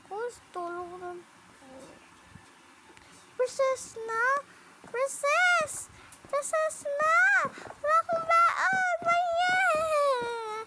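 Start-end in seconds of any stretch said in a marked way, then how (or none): 3.3-10.6 loud, high excited calling out; excited shout. Pretend. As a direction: cheer for recess. Announcing recess excitedly.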